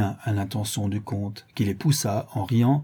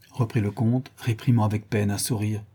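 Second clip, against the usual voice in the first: plain reading voice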